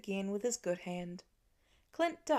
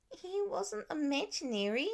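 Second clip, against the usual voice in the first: put-on higher child voice, sulky pout; 8 semitones higher than usual. A narrator's reading. Nate to his teasing siblings, higher pouting child voice. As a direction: pout it like a hurt five-year-old. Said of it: "Nate pouted".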